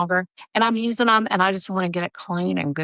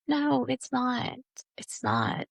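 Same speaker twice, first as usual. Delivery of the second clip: softer, slow, dropping denial; quiet insistence; 5 semitones higher, 8 dB quieter, much slower than usual. Real, not acted. She answers the imagined critic, softer and slow. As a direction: calmly shut the critic down.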